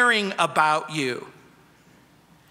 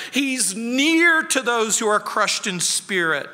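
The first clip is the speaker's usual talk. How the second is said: ordinary delivery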